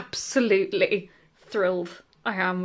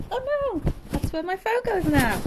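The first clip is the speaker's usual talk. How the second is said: mock dismay; 10 semitones higher than usual. Real, not acted.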